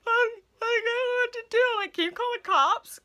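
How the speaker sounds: high, loud mock-distraught plea; panicked crying, imitated. Daughter calling her mom during a panic attack, high panicked imitation. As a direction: act out a frantic wail for help. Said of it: "panic attack and crying".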